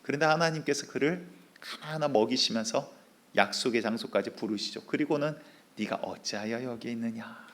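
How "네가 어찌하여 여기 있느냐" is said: much softer, gentle question; God speaking softly to the prophet; still small voice. Acted. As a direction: ask it in a faint, still voice. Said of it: "미세한 세미한 소리로".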